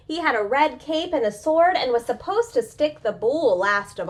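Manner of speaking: dramatic story reading. A narrator's reading. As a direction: announce each bullfighter with growing excitement.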